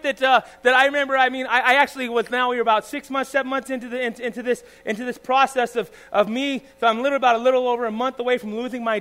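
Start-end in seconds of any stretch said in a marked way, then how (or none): none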